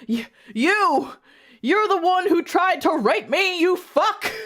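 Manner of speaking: high, loud hammed-up outrage; angry accusation. A narrator's reading. Edward confronts his attackers, high loud mock-furious shouting. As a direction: shout the accusation, stammering at first.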